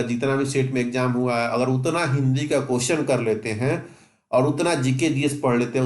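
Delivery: ordinary talk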